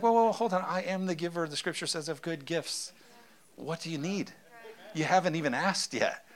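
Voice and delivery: put-on calm a panicked child kindly; gentle God voice. God gently calming a worried believer, soft and reassuring.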